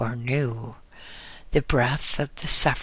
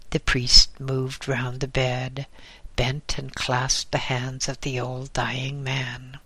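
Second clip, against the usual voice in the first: ordinary delivery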